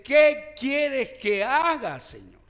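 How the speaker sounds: loud, drawn-out, high cry to the Lord; fervent slow plea. Shown as a demonstration. Preacher shows the church how to ask, loud slow plea. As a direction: plead it slowly with full force. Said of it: "tenemos que decirle".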